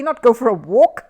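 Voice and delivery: loud, exasperated jab; cross. A narrator's reading. Host scolds Paddington, cross and loud. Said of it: "said Ronnie Playfair crossly".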